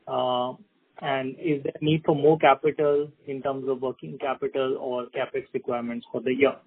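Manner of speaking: ordinary delivery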